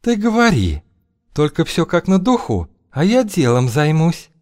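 high, sly woman's voice. Acted. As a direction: purr it, way up high. Feodosia's voice held.

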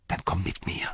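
soft, breathy rider's invitation; secretive young rider. Acted. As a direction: murmur it like a secret.

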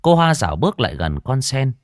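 plain reading voice

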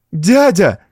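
desperate cry. A narrator's reading. Niece to her uncle, desperate cry. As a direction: cry out in despair. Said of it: "в отчаянии закричала Франсуаза".

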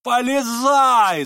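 loud, high, drawn-out roar; Фердыщенко. A narrator's reading. Фердыщенко roars at Ганя to reach in, roaring. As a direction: roar the order, stretching it out. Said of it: "заревел Фердыщенко".

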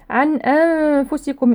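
slow recitation, humming the ن of أنفسكم into the ف; hidden nun. Shown as a demonstration. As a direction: hold a nasal hum before ف in أنفسكم. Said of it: "إخفاء النون الساكنة عند حرف الفاء".

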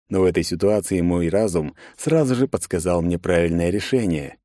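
usual speaking voice

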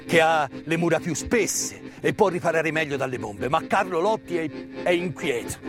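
ordinary talk

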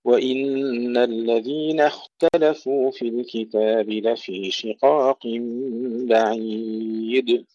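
slow, drawn-out chanted recitation; Quran recitation. Acted. Teacher recites the verse to students, slow tajweed chant.

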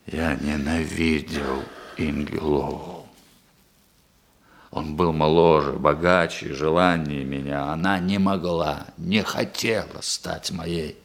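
low, brooding confession; bitter confession. Acted. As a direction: confess the old hatred in a heavy, sunken voice. Confesses hatred and jealousy.

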